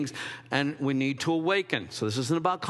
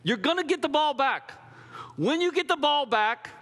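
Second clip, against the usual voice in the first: ordinary delivery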